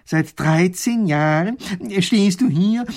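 loud, slow start climbing high; disbelieving professor. A narrator's reading. Professor confronting the soldier, incredulous. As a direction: spell it out in utter disbelief. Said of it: "Pfiffikus will es immer noch nicht glauben".

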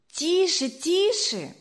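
lightly voiced family hushing him; shushing. Family hushes the boy at the table, mildly. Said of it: "раздалось со всех сторон".

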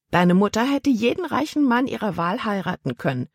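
a hint of a disapproving old aunt; lightly voiced stern aunt.

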